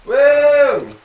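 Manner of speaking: loud, high startled whoa; startled shout. Real, not acted. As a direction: yelp as the horse bucks. Horse bucks him off.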